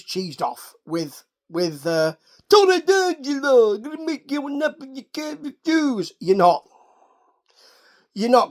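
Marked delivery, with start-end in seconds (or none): 2.5-6.1 mock mobster. Recapper mocking Tony D'Angelo's mob schtick, raised mock mobster voice. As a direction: ham up a gangster making an offer. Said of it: "mock mobster offer line".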